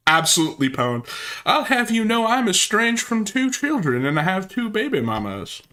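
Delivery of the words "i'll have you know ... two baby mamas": mock Ralph boast. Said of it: "mocking Ralph's boast".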